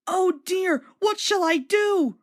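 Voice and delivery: worried. A narrator's reading. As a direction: fret aloud in alarm.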